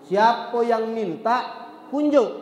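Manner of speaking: slow build, then kunjuk bursts out high and loud; slow lead-up, then a high loud kunjuk; punchline stress. Acted. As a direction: drag out siapa yang minta, then hit kunjuk high and loud. Punchline translation in Palembang dialect.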